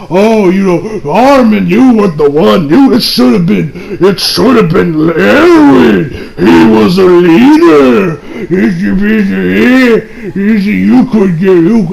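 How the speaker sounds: mocking, slow, wailing complaint; exaggerated imitation of a sore loser's lament; petty. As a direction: drag the words out in a petty whine. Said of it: "this motherfucker being petty as hell".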